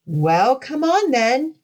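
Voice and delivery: high-rising goat challenge; crying out. A narrator's reading. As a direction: call out a dare as the big goat. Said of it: "cried the big billy goat".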